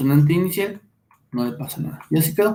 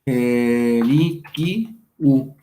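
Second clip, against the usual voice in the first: "erikiu" drawn out syllable by syllable; very slow, deliberate teacher's demonstration; sounded out slowly; 3 semitones lower, much slower than usual. Shown as a demonstration. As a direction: stretch each syllable of "erikiu".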